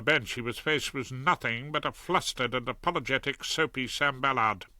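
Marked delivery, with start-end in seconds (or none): none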